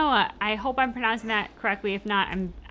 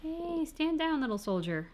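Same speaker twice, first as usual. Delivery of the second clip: high coaxing pet voice; high sing-song reassurance to a dog; 7 semitones higher, 5 dB quieter, slower than usual. Real, not acted. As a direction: soothe a scared puppy in a high voice. Soothing dog.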